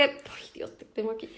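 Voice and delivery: hushed whisper to herself; whispered aside. Real, not acted. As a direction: breathe it under your breath. Aside while holding baby.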